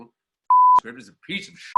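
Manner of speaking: loud, high mock outburst; an acted star's loud, high curse; fed-up star. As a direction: blow up as an imitated furious diva. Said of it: "chain smoking and tapping her foot and saying".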